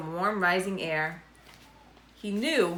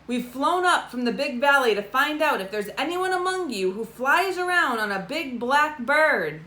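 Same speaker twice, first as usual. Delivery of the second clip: higher and louder, slowing at the end; addressing a crowd; 6 semitones higher, 3 dB louder than usual. A narrator's reading. Cousin Dinky asking the hill tinies, raised voice. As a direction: project to a whole crowd.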